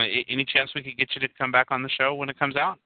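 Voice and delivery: ordinary delivery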